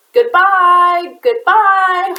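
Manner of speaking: high, loud sung goodbye; hollered. A narrator's reading. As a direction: call it out across the distance. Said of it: "hollered the giant".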